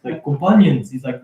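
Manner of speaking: normal talking voice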